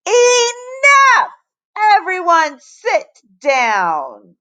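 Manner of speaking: high, loud furious roar, then slow command. A narrator's reading. Teacher silencing the rowdy class, roaring. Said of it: "Mister Jupiter roared".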